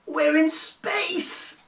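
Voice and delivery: high, loud, stretched-out shout of joy; Danny shouting with joy as they float in space; excited yell. Acted. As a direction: yell it like a thrilled kid. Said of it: "Danny yelled".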